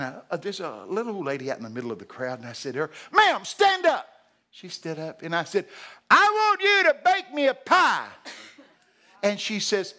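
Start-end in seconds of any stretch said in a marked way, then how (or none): 3.1-4.1 loud, high preacher's call; re-enacted shouted command. Calling out woman in crowd. 6.1-8.1 very loud, high preacher's holler; re-enacted booming call-out; shouted demand. Calling out woman in crowd.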